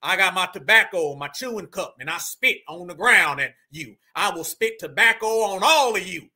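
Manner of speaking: low, threatening parody of a tobacco chewer; mock pissed-off Southerner. Reactor translating for viewers, mock angry Southerner. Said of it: "That's basically translation".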